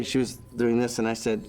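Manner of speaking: ordinary talk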